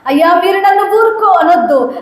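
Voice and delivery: rising high pleading; acted lament of the blind man; anguished protest. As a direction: pour out the pain. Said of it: "blind man protests".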